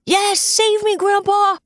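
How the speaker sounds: put-on high, loud child scream. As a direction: shriek for rescue. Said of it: "Luke screams".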